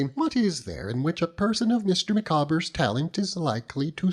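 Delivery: high, earnest matronly lady voice; high-pitched loyal wife holding forth; Mrs Micawber. Acted. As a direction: lift into the proud, insistent wife.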